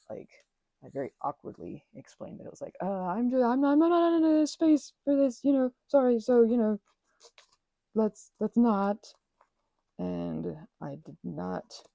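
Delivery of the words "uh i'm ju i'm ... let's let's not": high, loud, flustered letting him down; awkward flustered refusal. Retold or imitated. Turning down a friend's crush, awkwardly flustered. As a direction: stammer it out, mortified and apologetic.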